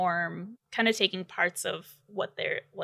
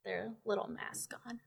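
whisper; 11 dB quieter than usual. Real, not acted. Aside while others talk.

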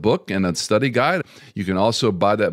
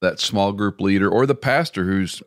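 usual speaking voice

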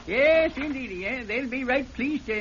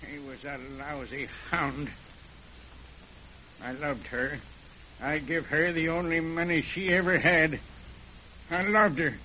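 broken confession; 7 semitones lower, 5 dB quieter than usual. Acted. Caught killer confessing to the sheriff, low and broken. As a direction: give up, each line heavier.

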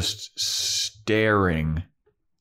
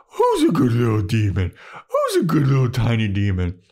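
put-on loud cooing baby talk; 3 semitones higher, 4 dB louder than usual. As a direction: talk to it like a puppy.

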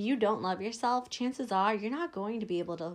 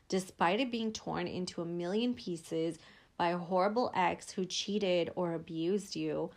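normal talking voice

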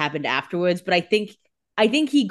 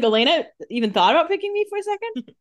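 quick, high-pitched disbelieving question; mock incredulous; 10 semitones higher, faster than usual. He hears Elena considered picking him, incredulous. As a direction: imitate amazed disbelief, pitched way up. Retold surprise at being considered.

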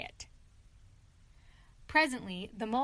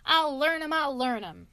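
loud, higher furious threat; angry shout; 4 semitones higher, 7 dB louder than usual. A narrator's reading. Toad threatening the stoats, loud and angry. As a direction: bellow it at invisible stoats. Toad raging at imaginary enemies.

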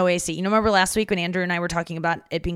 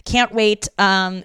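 usual speaking voice

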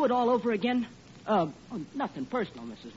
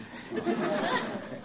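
nervous laugh; 9 semitones higher than usual. Acted. Arthur laughs nervously about honeymoon.

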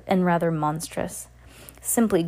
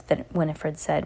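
plain reading voice

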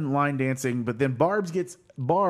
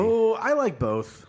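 high, slow exclaim; drawn-out emphatic protest; 9 semitones higher, slower than usual. Real, not acted. Defending Miracle Whip.

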